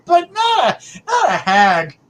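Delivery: real laugh breaking through. Real, not acted. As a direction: burst out laughing at the word. Laughing while rejecting the word hag.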